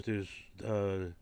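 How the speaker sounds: ordinary talk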